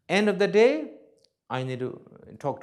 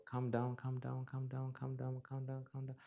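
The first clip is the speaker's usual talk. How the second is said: softer, fading repeated calm down; self-soothing; 11 dB quieter than usual. Shown as a demonstration. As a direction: talk yourself down gently. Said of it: "So I learn to reduce my ego".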